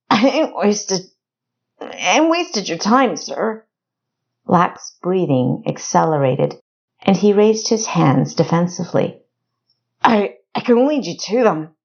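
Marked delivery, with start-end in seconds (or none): 0.1-3.6 higher, stammering backwoods voice; nervous rustic informant. Acted. Frightened informant pleading. 10.0-11.7 higher, stammering backwoods voice; nervous rustic informant. Acted.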